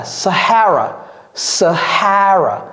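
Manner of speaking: "Sahara" as suh-HA-ra, middle syllable stressed; slow down and stretch the middle of "Sahara"; careful pronunciation. Shown as a demonstration. Teacher answers how it is pronounced, careful model. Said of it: "comment prononce-t-on ça?".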